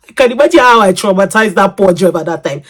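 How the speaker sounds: usual speaking voice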